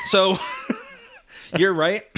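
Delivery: conceding through a laugh; laughing concession. Real, not acted. Conceding to his co-host while still laughing. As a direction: let a chuckle carry the words.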